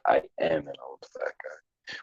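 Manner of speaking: breathy whispered confession, almost no voice; whispered reply. Pretend. Answering a viewer's teasing in a whisper. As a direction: whisper it like a sheepish admission.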